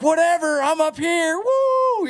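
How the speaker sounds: carefree fan hollering on stage; wild excitement. Retold or imitated. Fan hollering from stage to the band, very high whoop. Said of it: "And I'm like".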